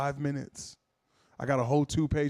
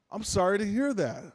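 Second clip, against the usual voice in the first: placating service rep; 6 semitones higher than usual. Acted. Rep placating angry caller.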